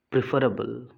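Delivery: प्रेफरेबल said "pri-fer-able", short i in the first syllable; correct pronunciation. Shown as a demonstration. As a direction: model प्रेफरेबल as pri-fe-ra-bel. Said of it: "this is the style".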